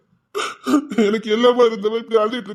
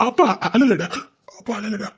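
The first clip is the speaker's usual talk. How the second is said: holding back tears. Acted. Father telling his child he is not crying, tearful. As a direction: stammer into it, choking back the crying. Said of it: "அப்பா அழாதீங்கப்பா".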